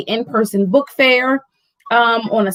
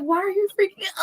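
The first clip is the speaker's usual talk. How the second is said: higher, quick puzzled question; daughter puzzled; 9 semitones higher, 6 dB quieter, faster than usual. Retold or imitated. Daughter watching her mom freak out, quick puzzled question. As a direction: ask like a confused kid. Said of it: "She was like".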